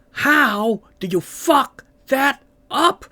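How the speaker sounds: very high, loud and slow yell; shouted quote. Retold or imitated. Mock-furious yell of the film line about the series. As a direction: stretch it into a huge yell. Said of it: "In the words of ... William Hurt".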